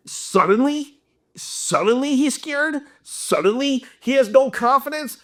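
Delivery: high, rising disbelief; incredulous. Real, not acted. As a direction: question it as if it makes no sense at all. Disbelieving rhetorical questions in rant.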